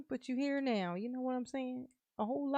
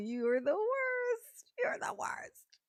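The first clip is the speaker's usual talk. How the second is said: teasing; 11 semitones higher, slower than usual. Real, not acted. Teasing Daryl, whispered repeat.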